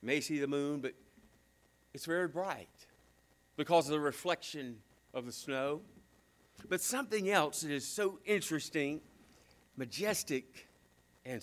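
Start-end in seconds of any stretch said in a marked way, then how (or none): none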